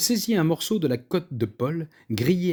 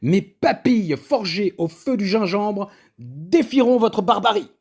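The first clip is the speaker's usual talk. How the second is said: defiant boast; 6 semitones higher, 5 dB louder than usual. A narrator's reading. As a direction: throw the gauntlet down theatrically. Cook's defiant retort.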